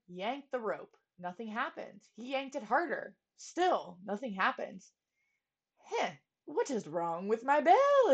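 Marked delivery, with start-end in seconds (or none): none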